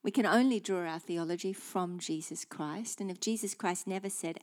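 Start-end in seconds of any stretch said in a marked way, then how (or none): none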